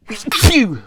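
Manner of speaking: sudden loud sneeze; sneezing. Real, not acted. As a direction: sneeze out of nowhere. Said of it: "I just sneezed out of the blue".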